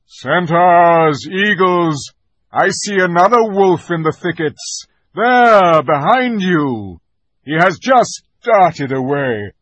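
loud, high commanding call; rally the troops with ringing orders; Aslan calling orders. A narrator's reading. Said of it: "shouted the voice of Aslan".

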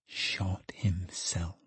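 slow, grave whisper; hushed revelation. A narrator's reading. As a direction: whisper the terrible news slowly. Secretary breaks news of suicide.

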